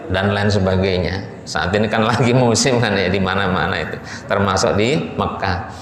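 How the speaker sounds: ordinary talk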